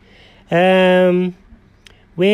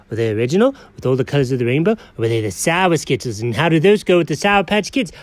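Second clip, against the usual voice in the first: ordinary delivery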